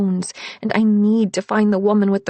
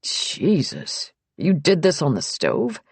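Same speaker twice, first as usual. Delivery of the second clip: shocked. A narrator's reading. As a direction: gasp at the wound, then ask. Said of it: "He grimaces".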